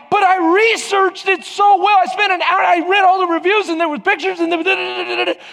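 high-pitched, pleading daughter imitation; mock earnest protest, pitched well up. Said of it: "And Raquel's like".

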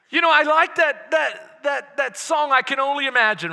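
loud, rising sermon voice; preaching at full force. Acted. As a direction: preach it out with fire.

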